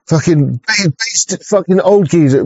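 ordinary delivery

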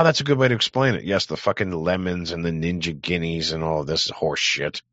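ordinary talk, nothing marked

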